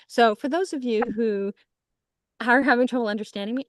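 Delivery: quick, rushed excited words; racing through it; talking fast. Real, not acted. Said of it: "I'm talking a mile a minute".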